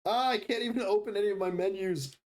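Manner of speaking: high, whiny exasperation; exasperated whine. Real, not acted. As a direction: complain at the stuck screen. Frustrated at computer.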